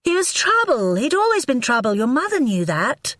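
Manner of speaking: high, biting rant about his brother; angry tirade. A narrator's reading. Wife rants at husband about his brother, high and angry. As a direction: keep raging at him, stress trouble. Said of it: "Livy screamed".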